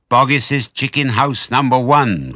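loud, high, drawn-out announcement; triumphant cry. A narrator's reading. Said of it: "cried Mister Fox".

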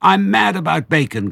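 high, loud, bursting with glee; excited badger. A narrator's reading. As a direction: shout it with a happy dance. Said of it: "cried Badger".

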